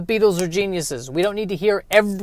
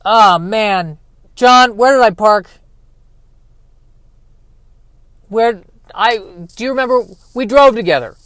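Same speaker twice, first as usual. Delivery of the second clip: louder, higher, flustered Paul asking John; [name] impression; 4 semitones higher, 6 dB louder than usual. Paul asking John where he parked, louder and higher. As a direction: panicky lost-car Paul, pitched up and loud. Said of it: "of [name] going".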